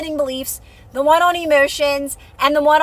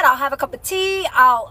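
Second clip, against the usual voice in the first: usual speaking voice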